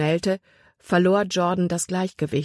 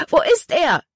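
much higher, louder, alarmed; panicked scream; 11 semitones higher, 5 dB louder than usual. A narrator's reading. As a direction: scream out searching the water. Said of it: "schrie sie". (panischer Schrei, viel höher)

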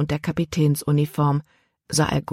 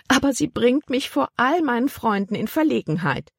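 higher, whining aunt; 8 semitones higher than usual. A narrator's reading. Aunt lamenting to the captain, higher whiny complaint. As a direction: moan it like a put-upon aunt. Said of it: "jammerte Tante Vi".

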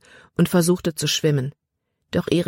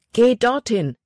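called out; 7 semitones higher, 3 dB louder than usual. Acted. Jordan directs Libby across stream.

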